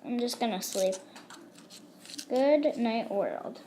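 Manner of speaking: drowsy fading. Acted. Drifting off to sleep.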